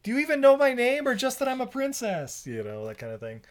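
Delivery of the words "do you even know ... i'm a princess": sassy princess voice. Retold or imitated.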